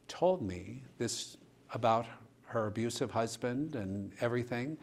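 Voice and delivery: ordinary talk, nothing marked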